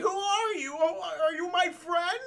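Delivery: high, wavering, hammed-up question; mock frightened plea. Half-blind man calls to a shadowy figure, mock fearful. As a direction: plead in a high, put-on helpless voice.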